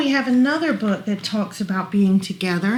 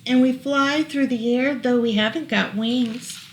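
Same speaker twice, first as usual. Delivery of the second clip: lilting rhyming read-aloud; reading to children; 3 semitones higher, 3 dB louder than usual. Acted. Parent reading a rhyming book to child, gentle lilt. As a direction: read the rhyme gently to a toddler.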